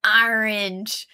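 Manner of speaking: loud, stretched ORANGE opening on "AR"; playful regional vowel on ORANGE; imitated American orange. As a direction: belt ORANGE as AR-inge. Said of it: "how some Americans say orange".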